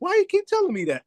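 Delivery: high, squeaky exasperated whine; mock-annoyed falsetto complaint; mock exasperation. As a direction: whine back at the boss in a falsetto. Annoyed retort, retold.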